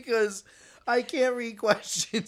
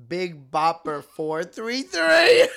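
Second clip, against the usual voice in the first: slow, louder dramatic reveal; 7 dB louder, much slower than usual. Real, not acted. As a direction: drag out the reveal.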